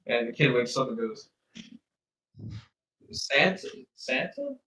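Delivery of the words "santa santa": put-on dazed child voice. Kid waking up dazed.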